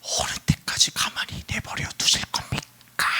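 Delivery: acted cry breaking into a strained whisper; anguished lament turning whispery; lamenting appeal. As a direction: start the plea voiced, then lose the voice in anguish. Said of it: "탄식하면서 하나님한테 호소하고".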